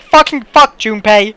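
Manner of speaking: exasperated swearing at the character; mock frustrated curse. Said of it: "I'm like".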